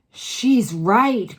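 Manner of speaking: loud agreeing cry; cried. A narrator's reading. Another witch backing Mildred, loud cry. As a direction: cry it out in support. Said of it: "cried another voice".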